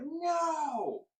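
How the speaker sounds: high, loud startled shout; sudden shout. Retold or imitated. Mother panicking at the restaurant table, sudden loud shout. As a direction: blurt it so the whole table jumps.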